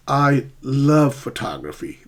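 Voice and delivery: "love" drawn out in "I love photography"; emphatic "love" as the sentence stress. Shown as a demonstration. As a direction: lean hard on the word LOVE, then fall. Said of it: "That word is a stress in the sentence".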